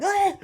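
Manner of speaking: high, loud startled yelp; choking yelp. Retold or imitated. Choking on popcorn in the cinema, high loud yelp. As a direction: gasp out as the popcorn catches. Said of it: "And I was like".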